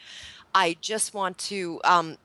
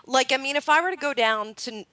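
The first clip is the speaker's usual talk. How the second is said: incredulous; 7 semitones higher than usual. Real, not acted. Incredulous raised voice.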